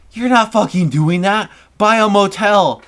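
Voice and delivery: high, loud mother's outburst; hammed-up angry mom laying down the law; a retold yell, pitched high and loud; mom's mock yell. Mom forbidding the plan.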